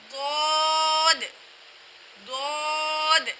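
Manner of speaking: ضاد heavy and rounded, vowel held very long; full-mouthed Dad. Shown as a demonstration. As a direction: say ضاد thick, as a long "Dood". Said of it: "موٹی آواز میں پڑھے جانے والا حروف". (موٹی آواز میں لمبا ضاد)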